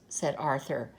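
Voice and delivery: ordinary delivery, nothing marked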